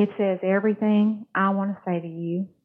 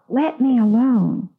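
louder, slow cry of protest; yelled plea; 4 semitones higher, 6 dB louder, slower than usual. Acted. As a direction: yell it out, begging to be left alone. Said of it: "and I yelled out".

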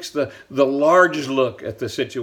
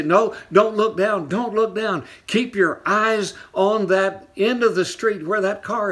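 father calling out advice; 7 semitones higher than usual. Retold or imitated. Dad running beside the bike calls to his child, urgent and encouraging.

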